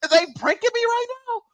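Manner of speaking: high-voiced, bewildered mock outrage; exasperated disbelief. Retold outrage at elevator buttons.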